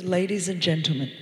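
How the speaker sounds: low, calm pilot on the loudspeaker; steady captain voice over the intercom; pilot announcement. Retold or imitated. Pilot calming the passengers, low steady announcement. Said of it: "the pilot comes over the loudspeaker and he goes".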